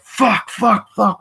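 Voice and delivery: high, loud mock swearing fit; retold outburst of frustration; acted frustrated cursing. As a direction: yelp each curse in exasperation.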